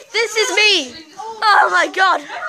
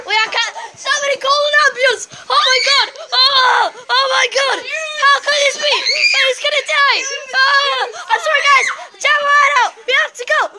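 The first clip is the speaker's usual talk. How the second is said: mock panic; 6 semitones higher than usual. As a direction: pretend someone is dying. Nah, I'm just kidding.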